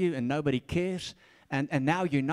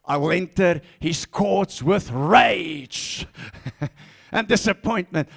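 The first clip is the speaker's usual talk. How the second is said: slow, louder, sulky rage; mock angry prayer; 4 dB louder, slower than usual. Shown as a demonstration. Preacher parodying an angry prayer, slow and louder. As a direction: spit the verse out in mock rage. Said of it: "Don't pray like that".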